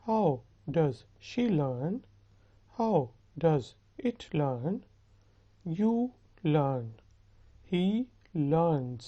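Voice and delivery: slow, spaced-out model forms; slow grammar drill. Shown as a demonstration. Teacher leading students through verb forms, slow and measured. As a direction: pause between words for learners to follow.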